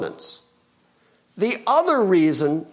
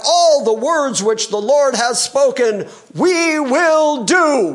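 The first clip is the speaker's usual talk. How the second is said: eager crowd; 6 semitones higher, 4 dB louder, slower than usual. Pretend. People promising, voiced mockingly.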